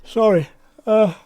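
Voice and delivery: talking through a yawn. Real, not acted. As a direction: yawn while saying it. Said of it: "always yawning".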